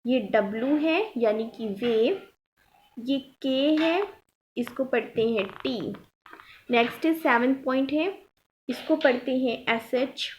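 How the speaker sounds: ordinary talk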